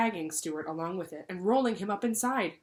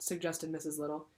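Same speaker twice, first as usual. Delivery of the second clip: plain reading voice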